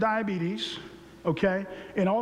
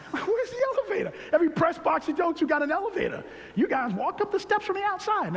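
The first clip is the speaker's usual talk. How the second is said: exasperated laughing complaint; 9 semitones higher than usual. Retold or imitated. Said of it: "I'm like".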